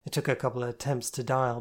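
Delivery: ordinary delivery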